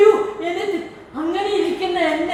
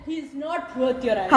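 dropped lower and softer; subdued plea; 6 semitones lower, 8 dB quieter than usual. Real, not acted. Calming the angry speaker down, low and subdued. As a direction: bring it down to talk someone out of anger.

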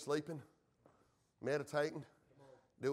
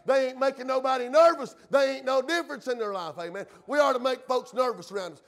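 forceful, ringing pulpit declaration; full-force preaching; 4 semitones higher, 6 dB louder than usual. Real, not acted. Preacher tells church he's not ashamed, full-force preaching. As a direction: preach it boldly and keep driving.

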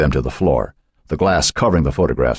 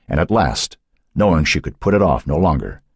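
ordinary delivery

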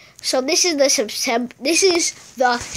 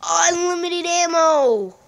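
higher, slow, awed; delighted gasp; 6 semitones higher, 3 dB louder, slower than usual. Real, not acted. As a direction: say it amazed. Gasps at power-up.

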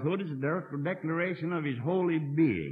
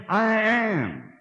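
loud I am; 5 semitones higher, 4 dB louder than usual. Shown as a demonstration. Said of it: "because he said".

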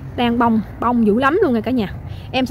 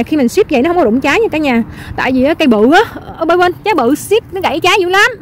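excited hollering; 4 semitones higher than usual. Real, not acted. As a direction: holler with delight to the viewers. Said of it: "Tự nhiên tới khúc này em la lớn quá". (la lớn đầy phấn khích)

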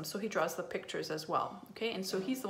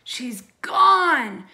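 very loud, high gleeful scream; 6 semitones higher, 14 dB louder than usual. A narrator's reading. Twins screaming once their grandmother has left, screaming. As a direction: yell it out, stretching the last word. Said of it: "screamed the twins".

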